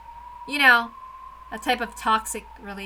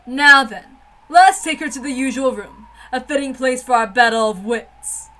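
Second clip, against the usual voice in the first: louder, more declaiming line reading; bolder retake; 10 dB louder than usual. Shown as a demonstration. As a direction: say it again with full dramatic weight. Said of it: "Did I say that right?".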